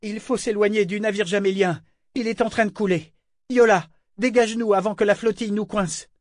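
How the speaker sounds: high, urgent commands; shouted orders. A narrator's reading. Yémin to crew of sinking-ship danger, shouting. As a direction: call out orders in a high, pressing voice. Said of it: "puis il cria à l'équipage".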